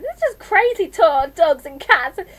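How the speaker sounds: high, loud, tearful tantrum; shouting. A narrator's reading. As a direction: shout it in furious disbelief, ending in a wail. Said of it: "Stop shouting at me".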